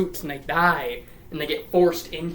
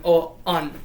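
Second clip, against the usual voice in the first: usual speaking voice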